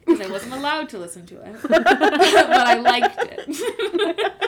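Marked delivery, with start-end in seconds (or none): 1.7-4.3 laughing. Real, not acted. Confessing, laughing hard.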